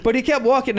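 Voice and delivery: ordinary delivery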